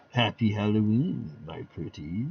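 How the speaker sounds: low, sinister hissed farewell; crypt host cackle. Acted. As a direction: send them off with a creepy grin. Host's sign-off.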